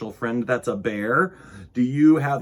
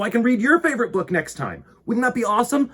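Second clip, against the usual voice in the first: excited; 12 semitones higher, 6 dB louder, faster than usual. Real, not acted. Host rallies kid viewers.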